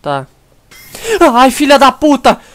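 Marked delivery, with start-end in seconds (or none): 1.3-2.4 loud sudden swear, higher; startled curse. Real, not acted. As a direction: blurt it out in shock. Jump scare in horror game.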